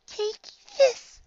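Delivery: high, squeaky battle cry; little yell. A narrator's reading. Shy pony throwing snow back, squeaky yell. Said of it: "she yells".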